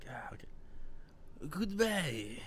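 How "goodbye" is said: put-on French accent. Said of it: "in your best French accent".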